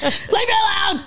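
playful shout. Real, not acted. Teasing co-host yelling at Kyle to leave her alone, playful shout. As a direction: shoo him off with a laughing yell.

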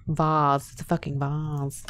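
quietly insisting on vase as vahz; soft firm vahz. Shown as a demonstration. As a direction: settle it softly: vahz.

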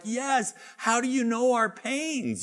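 high, pleading client voice; mock-plead like a grateful client; a high mock plea from the clients.

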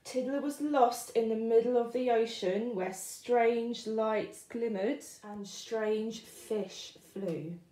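plain reading voice

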